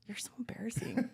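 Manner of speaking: whispered taunt; inner whisper. Retold or imitated. Thinking about the fleeing boyfriend, whispered taunt. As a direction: breathe it as a private thought. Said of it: "in my head, I was going".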